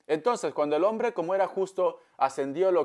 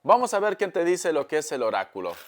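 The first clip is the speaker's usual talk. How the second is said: ordinary talk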